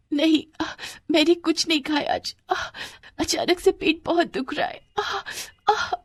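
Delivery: put-on high, whimpering girl voice; acted stomach-ache groaning; in pain.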